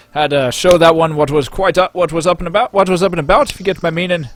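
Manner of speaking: loud, high boozy storyteller; rowdy drunk patron. Acted. Drunk patron boasting to his mates, loud and high. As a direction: brag it out to your drinking mates. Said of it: "and says".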